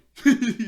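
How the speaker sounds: loud, high real laugh; loud burst of laughter. Real, not acted. Host cracking up at Jeremy's "sorry", loud laugh.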